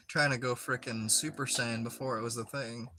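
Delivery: normal talking voice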